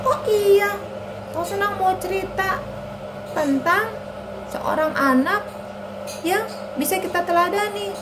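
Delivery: put-on high puppet-girl voice; eager child Ani. Ani invites Anto to hear the story, childlike. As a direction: chirp it like a little kid.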